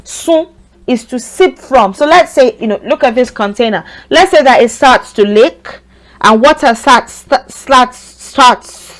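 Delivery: ordinary delivery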